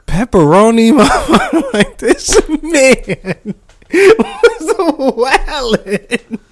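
high squeals of laughter through the words; laughing fit; laughing hard. Real, not acted. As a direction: crack up while saying it. Laughing at the British word.

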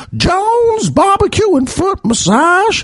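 very high, drawn-out hawker's cry; imitated commercial pitchman. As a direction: belt out the slogan in a silly high voice. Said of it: "the man with the dinosaur and the chicken going".

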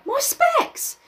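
high panicked shriek; cry of terror. Acted. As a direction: yelp it out in terror. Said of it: "Piggy cried out in terror".

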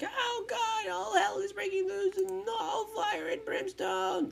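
high, slow hammed-up wail of doom; mock doom panic. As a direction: play the hysterical fan crying that the world is ending. Mocking his own panicked fan reaction.

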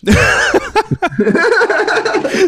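long, high burst of laughter. Real, not acted. Host reacting to co-host getting too serious, long high laugh. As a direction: crack up at your friend.

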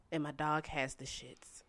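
hushed confidential whisper. Real, not acted. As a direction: whisper the gross part. Whispered confession of gross detail.

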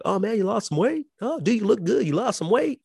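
high, chirpy mock compliment; imitating friends. Friends complimenting his weight loss, high and chirpy. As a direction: squeak out fake praise, pitched high. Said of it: "people are like".

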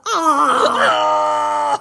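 a loud wailing cry; mock wail. Retelling the carriage scene, a loud mock wail. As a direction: let out a big pained wail. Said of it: "So I was like".

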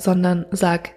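ordinary talk, nothing marked

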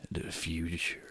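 hushed whisper for effect. Acted. Telling listeners the podcast is the future, whispered. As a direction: breathe it out like a secret.

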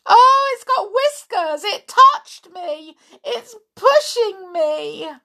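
terrified high doll voice; frightened. Acted. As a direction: squeal as if something is pushing you. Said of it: "She was just as frightened".

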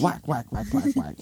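high, rapid repeated whacks; squeaky cartoon blows; swatting sound effect. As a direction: act out quick swats with the word.

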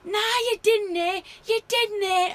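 high, loud Scottish yell; mimicked Scottish shouting, pitched right up; shouted Scottish accent. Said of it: "in his Scottish accent, he shouts".

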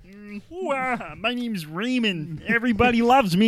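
breathy high put-on voice, slow and drawn out; slow high mock persona; silly character voice. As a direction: play a goofy self-loving character. Silly intro voice as Raymond.

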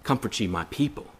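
soft, gentle consoling read; softly comforting scripture; tender comfort. Shown as a demonstration. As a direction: hush it like comforting a grieving friend. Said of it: "Elijah didn't go to Sinai to say".

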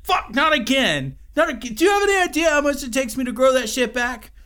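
high, loud whiny California surfer groan; a frustrated surfer dude whining; California accent. Retold or imitated. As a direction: Mothman moans in a California accent about his wings. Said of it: "he has a California accent".

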